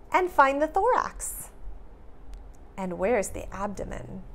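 put-on bright, lively read-aloud for kids; animated picture-book reading; reading to children. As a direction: read it like story time for little ones.

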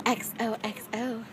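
soft sign-off in a gossip narrator's voice; Gossip Girl. Pretend. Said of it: "I feel like Gossip Girl on these vlogs".

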